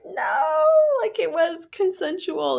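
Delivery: very high-pitched drawn-out no; mock squeal of protest; retold protest. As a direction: plead high in objection. Protesting to furious friend, retold.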